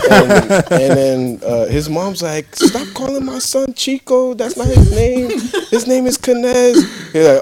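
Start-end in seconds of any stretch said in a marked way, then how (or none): 2.6-6.7 high indignant mother correcting him; scolding mom. Retold or imitated. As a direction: mom snapping at the cousin over her son's name.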